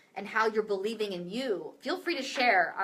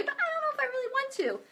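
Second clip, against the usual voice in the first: high, fast anxious squeak; mock-anxious high whine; imitated anxiety; faster than usual. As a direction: squeak out the reluctance, mimicking an anxious inner voice. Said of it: "that's the voice of anxiety".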